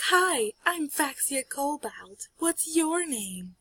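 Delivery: high, drawn-out friendly hello; girl's greeting. Acted. Girl introduces herself in story.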